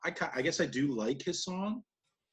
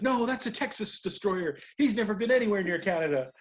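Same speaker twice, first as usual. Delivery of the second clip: higher, louder mock objection; blustering Jericho; 5 semitones higher, 4 dB louder than usual. Jericho corrects Tony on commentary, mock bluster. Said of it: "part of his blustering character".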